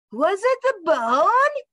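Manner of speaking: high, drawn-out call. A narrator's reading. Dog calls to the bird for an answer, high and slow. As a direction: call the question out, stretching the last word. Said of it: "he called to the bird".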